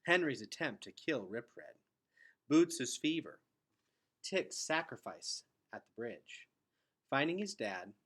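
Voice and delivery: usual speaking voice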